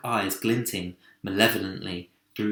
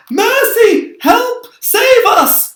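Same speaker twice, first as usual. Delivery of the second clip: very high, loud screams for help; terrified cry; 13 dB louder, much slower than usual. A narrator's reading. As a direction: scream for rescue. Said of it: "cried the ladybird".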